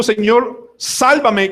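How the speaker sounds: high-pitched drowning plea; crying out for rescue. Acted. Preacher acting the drowning man's cry, crying out. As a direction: call out urgently to be saved. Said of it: "Si él está gritando".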